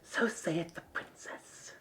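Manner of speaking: slow whispered mumble in his sleep; a sleeping familiar whispering slowly; sleep-talking whisper. Acted. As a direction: murmur it half asleep, barely voiced. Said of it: "Bob in his sleep just says".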